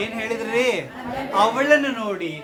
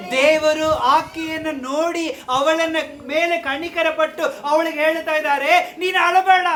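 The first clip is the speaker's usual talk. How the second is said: ringing pulpit delivery; forceful preaching; 6 semitones higher, 5 dB louder than usual. Real, not acted.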